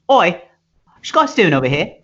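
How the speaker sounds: high mock English accent; aggressive English lady. English lady accosting fiber workers, aggressive mock English accent. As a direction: challenge them in a put-on English accent. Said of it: "'cause she's English, they always begin polite, and then it starts getting aggressive".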